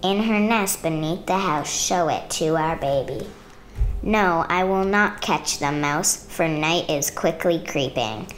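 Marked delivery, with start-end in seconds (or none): none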